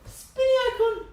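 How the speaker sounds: very high squealed singsong with laughter; mock excitement. Streamer reacting to a stuck loading icon, mock-excited squeal.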